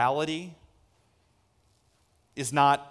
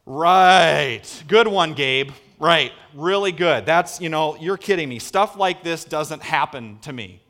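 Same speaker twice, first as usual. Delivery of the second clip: loud, drawn-out mock agreement, then easing off; sarcastic disbelief; 7 dB louder than usual. Preacher as Mary answering Gabe, sarcastic disbelief. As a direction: humour the angel without believing a word. Imagined disbelieving reply to the angel.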